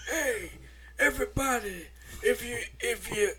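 lightly put-on nasty-sounding character voice; Ronnie. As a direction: do a halting TV-character impression.

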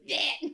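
baby burp imitation. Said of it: "he's like".

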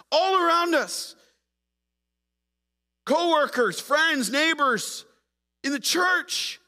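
loud, high, slow pulpit cadence; full-force preaching. Acted. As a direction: preach it to the whole room.

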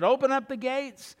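slightly high call to open the gates; the tricked watchman giving the order to open; mild call. Acted. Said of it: "the one that said".

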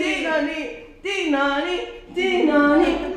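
high sung siren "tinoni"; siren sound. Shown as a demonstration. Storyteller imitating the fire engine siren for the children. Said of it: "Como é que fazia a sirene dos bombeiros?".

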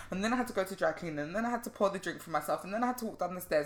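hurried imitation of a breathless to-do list; rapid-fire list. Friend mimicking her listing every chore, rapid-fire list. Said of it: "she'll say it in a list as fast as how she felt she did it".

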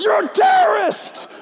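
high accusing yell; shrill store crowd accusation; mock accusing shout. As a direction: shout it like an outraged shopper. Shoppers accuse maskless person.

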